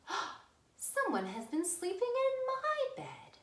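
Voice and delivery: Mama Bear voice. Acted. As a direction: lift it up into a fussy mama bear.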